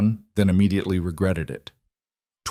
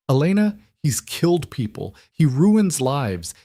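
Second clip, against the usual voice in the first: urgent outburst; 6 semitones higher than usual. Acted. Friend pleading, warning.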